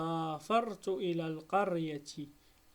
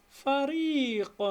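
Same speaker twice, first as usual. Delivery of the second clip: slow and louder, higher, syllables apart; slow syllable split; 6 semitones higher, 5 dB louder, much slower than usual. Shown as a demonstration. Teacher to pupils, splitting the word, slow and high. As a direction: say it slowly for the class to split. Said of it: "نجزئها فريق".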